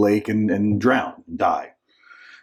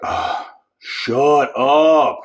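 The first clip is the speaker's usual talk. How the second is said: loud, higher-pitched groggy outburst; groan, then yell it out, fed up; grumpy; 5 semitones higher, 6 dB louder, much slower than usual. Acted. Grumpy Jesus woken up, rebuking the storm, irritated yell. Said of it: "he's grumpy, he's like".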